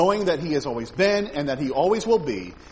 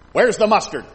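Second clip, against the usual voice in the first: louder, higher, indignant question; indignant complaint; 5 semitones higher, 8 dB louder than usual. Retold or imitated. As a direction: play the startled grandmother demanding mustard. Grandmother complains about missing mustard.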